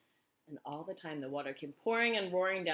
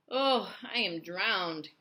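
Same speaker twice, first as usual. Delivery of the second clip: slow, louder gasping cry; gasped; 3 semitones higher, 6 dB louder, much slower than usual. A narrator's reading. Grasshopper spitting out water, slow gasping cry. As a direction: gasp it out half drowned. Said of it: "gasped the old green grasshopper".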